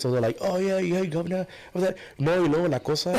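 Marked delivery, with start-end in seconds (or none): 0.4-2.0 high-pitched mock British accent. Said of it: "they talk English in a British accent". 2.2-3.1 imitated switch from British English into Spanish; raised-pitch Spanish imitation. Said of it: "and then they switch it".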